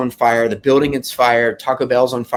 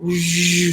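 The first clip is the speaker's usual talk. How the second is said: high whoosh held briefly; sustained high zhoo, like a gust; imitated wind gust; 6 semitones higher than usual. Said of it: "it would just be like".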